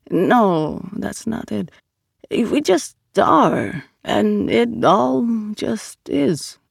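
high, drawn-out character voice; Vito, groping for words. Acted. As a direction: stretch each idea out slowly.

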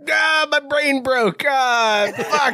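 high, hammed-up brain meltdown; mocking high-pitched befuddlement; mock flustered Drew. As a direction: imitate a flailing friend, groaning high. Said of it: "Drew would be like".

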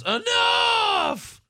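high, drawn-out shout; mock exasperated holler; imitated yell. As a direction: yell it way up high to break up a fight. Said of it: "until Daniel Bryan yells".

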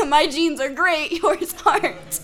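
high, loud, laughing accusation; laughing mock yell. New mother blaming boyfriend for baby's head, laughing yell. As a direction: act out yelling at him, cracking up. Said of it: "And I'm yelling at him".

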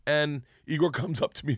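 usual speaking voice

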